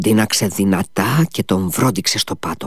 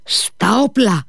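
put-on old man's howl; 11 semitones higher, slower than usual. A narrator's reading. Old man calling the house to arms, a contained howl. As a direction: draw out a high rallying cry. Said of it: "ούρλιαξε ο γέρος".

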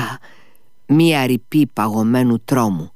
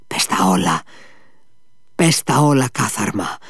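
grab him and force it out; furious demand. A narrator's reading. Ivan grabs his shoulder.

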